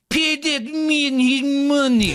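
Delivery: put-on high, slow, mangled mystery accent; Malkovich impression. Host mocking Malkovich's Rounders accent, slow and high. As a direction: drag out each word in a bad fake accent. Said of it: "John Malkovich, like".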